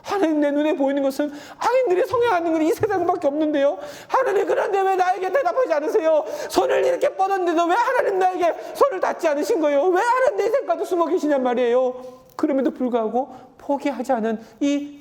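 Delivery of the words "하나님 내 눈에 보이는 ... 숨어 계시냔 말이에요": pleading cry to God. Retold or imitated. Preacher voicing the psalmist crying out to a silent God, high anguished plea. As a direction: cry out to God as if he won't answer. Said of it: "시편의 이 기자의 마음처럼".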